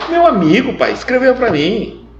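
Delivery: put-on eager child explaining to his father; lightly voiced child; son answering.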